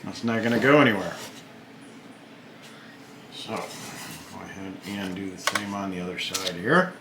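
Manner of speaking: talking around a toothpick; words slurred by a toothpick in the mouth. Real, not acted. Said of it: "I'm talking funny 'cause I got the one end of the toothpick in my mouth".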